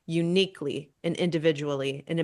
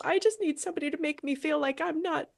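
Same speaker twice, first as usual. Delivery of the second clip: younger self's hurt; 7 semitones higher than usual. Pretend. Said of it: "actually listening to yourself".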